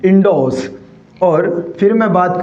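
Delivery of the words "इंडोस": "इंडोस" with no r sound; correct pronunciation. Shown as a demonstration. Teacher repeats for students the correct "इंडोस", r silent. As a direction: say "इंडोस" with long o straight into s. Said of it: "क्या बोला जाएगा मेरे साथियों?".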